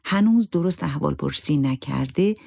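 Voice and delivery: ordinary delivery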